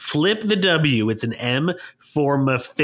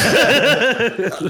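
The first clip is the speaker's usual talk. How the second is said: loud laugh; 11 semitones higher, 5 dB louder than usual. Real, not acted. Laughing at calling him bitch.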